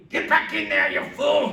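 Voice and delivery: loud, rough trainer's shout; Mickey yelling. Retold or imitated. Preacher voicing Rocky's trainer, a loud shout. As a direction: bark at the boxer to get back in. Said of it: "One of my favorite lines".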